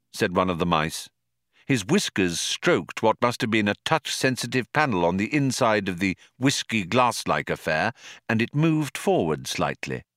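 usual speaking voice

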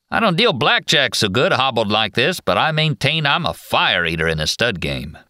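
high drawling Western cowboy voice. Acted. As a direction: swagger it like a movie cowboy. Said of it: "in his drawling cowboy actor's voice".

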